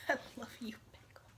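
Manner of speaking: very soft, near-whispered sweet talk; affectionate. Real, not acted. Telling a pet she's loved, softly affectionate. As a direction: coo it quietly.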